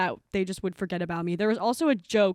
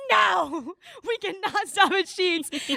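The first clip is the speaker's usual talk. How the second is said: mock aggressive refusal; 7 semitones higher, much slower than usual. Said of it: "she was getting really aggressive".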